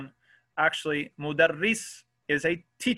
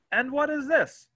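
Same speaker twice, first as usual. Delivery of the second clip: high questioning voice; 10 semitones higher than usual. Shown as a demonstration. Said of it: "So we'll start to say".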